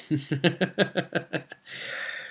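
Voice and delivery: soft high giggle; long, quiet, high laugh; chuckle. Real, not acted. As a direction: chuckle to yourself. Laughs after the sacrifice line.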